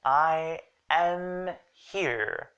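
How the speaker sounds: very slow, each word of "I am here" held; "am" given full, drawn-out weight; emphatic I AM. Shown as a demonstration. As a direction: stretch out "I am" for emphasis. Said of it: "for emphasis".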